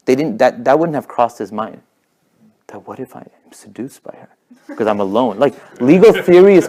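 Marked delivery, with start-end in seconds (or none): 2.7-4.3 mock worried aside. Mocking a man's imagined scruple, hushed mock worry. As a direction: drop to a hushed, pretend fret.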